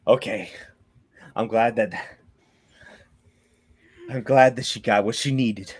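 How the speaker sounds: low, soft, measured relief; calmed down. Acted. Quest-giver glad the old lady got what she needed, calm and quiet. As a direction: settle yourself, pausing between phrases. Calming down after outburst, breathing slowly.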